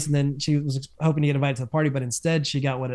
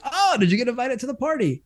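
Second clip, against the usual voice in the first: mock surprise; 9 semitones higher than usual. Brody voiced asking.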